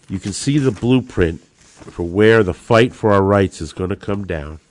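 usual speaking voice

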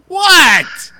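high, loud mock-indignant "what"; defensive retort. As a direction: blurt it out high and loud, playing innocent. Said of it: "and I'm like".

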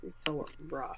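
slow, whispered reaction; hushed sympathy. Real, not acted. Co-host reacting to the episode's sad ending, hushed. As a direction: let it out in a slow, pained whisper.

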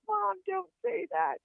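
very high, drawn-out mock plea; pleading protest. Daughter begging mom at the bedside, high pleading protest. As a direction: squeal it slowly as a retold plea. Retold plea to mother, repeated.

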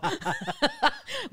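high-pitched laughter. Real, not acted. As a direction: laugh at your own joke. Laughing at own joke.

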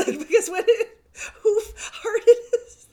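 very high, loud, slow laugh-cry; tears of laughter in every word; helpless laughing over the fart pun. Real, not acted.

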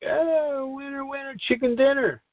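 high, giddy winner's cheer; mock gleeful gambler cheering a big win; excited win cheer. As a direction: crow it like you just hit the jackpot. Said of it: "you're like".

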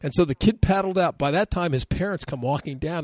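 ordinary talk

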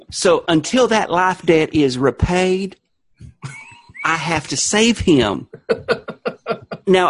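Southern revival-meeting witness; Southern testifying. Pretend. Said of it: "you're gonna start testifying to me".

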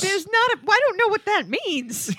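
high, flustered mock objection; baffled protest. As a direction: object in rising bewilderment.

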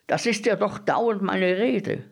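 high-pitched, worked-up insistence; exasperated, high-voiced insistence; insistent agreement. A narrator's reading. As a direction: push the pitch right up to insist. Bread dealer repeats himself more insistently.

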